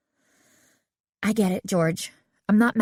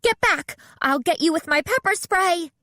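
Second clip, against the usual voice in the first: high threatening yell; 11 semitones higher than usual. A narrator's reading. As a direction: shout her off with a threat. Bess threatens stranger with spray.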